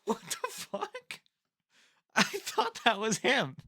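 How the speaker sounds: high voice squeezed through laughter; laughing in disbelief. Real, not acted. Fighter picker baffled by own mix-up, laughing in disbelief. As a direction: crack up while getting the words out.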